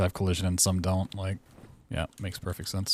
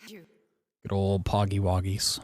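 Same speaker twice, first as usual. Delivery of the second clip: slow silly drawl; much slower than usual. Pretend. Playful joke about pogging.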